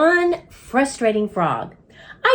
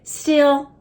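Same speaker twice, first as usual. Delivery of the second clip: ordinary delivery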